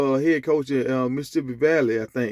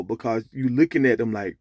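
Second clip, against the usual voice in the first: ordinary talk, nothing marked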